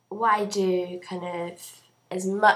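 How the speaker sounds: ordinary talk, nothing marked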